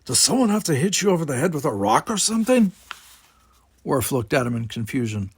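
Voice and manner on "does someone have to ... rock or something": lightly voiced brother, higher, teasing; a lightly voiced younger brother nudging him; Simon's voice. A narrator's reading. Simon, Worf's brother, chides.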